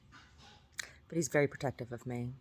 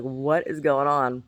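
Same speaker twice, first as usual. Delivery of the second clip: bewildered onlookers; 7 dB louder, much slower than usual. Retold or imitated. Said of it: "People would stop and be like".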